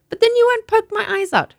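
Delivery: put-on high, fretful child's voice; a small girl sure she's itching; whining little girl. Child arguing with mother.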